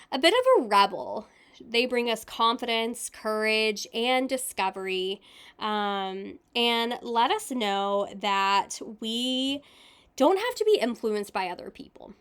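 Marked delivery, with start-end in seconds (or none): none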